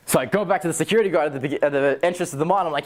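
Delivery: ordinary talk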